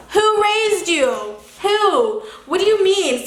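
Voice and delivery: high, slow, incredulous; outraged disbelief. Real, not acted. Outraged at the spit snowball.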